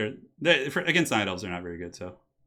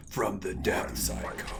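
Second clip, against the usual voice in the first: slow, lightly imitated Crypt Lord; 4 semitones lower, 6 dB quieter, much slower than usual. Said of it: "his voice is so cool".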